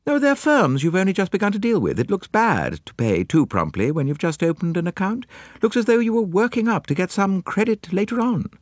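matter-of-fact secretary lecturing her employer; Miss Lemon voice. A narrator's reading. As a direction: explain it as plain business sense. Miss Lemon explaining bills.

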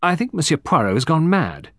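a touch of angry exasperation. A narrator's reading. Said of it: "Ruth said angrily".

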